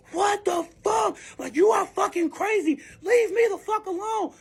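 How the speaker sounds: high, loud mimicry of him; high-pitched retold shouting; outraged yelling. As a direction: shriek it like a fed-up man. Acts the man after the crash.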